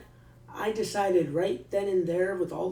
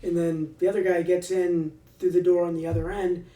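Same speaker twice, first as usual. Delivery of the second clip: usual speaking voice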